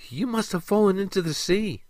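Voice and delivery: high, lilting female character voice; sweetly melodic, high voice; melodious mermaid. A narrator's reading. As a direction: raise the pitch and keep it melodious. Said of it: "the melodious voice stated".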